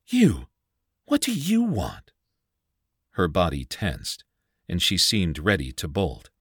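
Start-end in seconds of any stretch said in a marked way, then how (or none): 0.1-2.1 high, startled and scared; frightened cry. A narrator's reading. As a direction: jolt out the words in fright, high. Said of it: "eyes round with fright".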